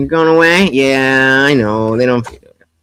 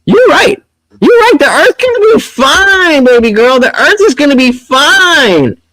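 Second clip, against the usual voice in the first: sarcastic agreement; 8 semitones higher than usual. Real, not acted. Mocking sarcastic reply to clip.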